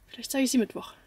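ordinary talk

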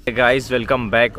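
ordinary delivery

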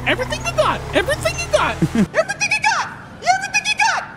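building from plain to very high, almost shrieking; mock frantic demand. Robber retelling demand to victim, building frantic high yell. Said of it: "I was like".

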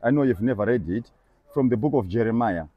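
ordinary talk

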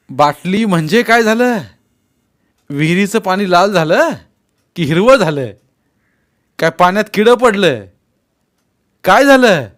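loud, high challenging questions; scornful, forceful interrogation; stern grilling. A narrator's reading. Elder grills boy about pollution.

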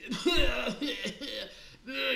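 put-on loud, high old man's hacking fit; hammed-up sickly coughing; mock coughing fit. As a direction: cough and splutter wildly, played big. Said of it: "il touchait sans bon sens".

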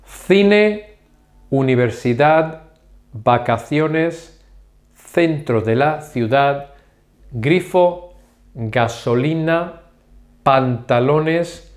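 each word slow and separate, clear Spanish sounds; slow Spanish review. Shown as a demonstration. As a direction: list the words slowly for repetition. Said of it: "let me repeat the Spanish ones".

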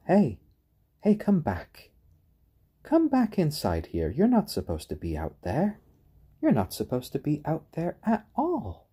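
mock scold, calling them back inside; chick shouting. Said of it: "Stormy was probably just shouting at them".

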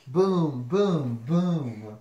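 loud, separate booms; thunder booms. Acted. Reader imitates thunder for the listener, booming. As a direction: boom it out like thunder. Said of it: "Mister Brown makes thunder".

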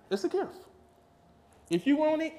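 "It's a gift" leaps high, rising; high exclaimed. Shown as a demonstration. Preacher repeating "It's a gift" to the congregation, high exclaimed. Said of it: "you don't have to work hard".

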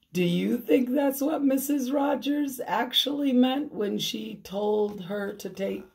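ordinary delivery, nothing marked

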